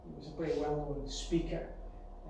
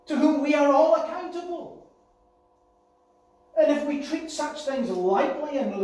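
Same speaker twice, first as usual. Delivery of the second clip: fervent preaching; 7 semitones higher, 9 dB louder than usual. Acted. Preaching at full force.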